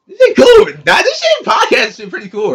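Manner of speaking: loud, high, hyped; excited shout. Real, not acted. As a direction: yell it out, hyped up. Excited shout about podcast.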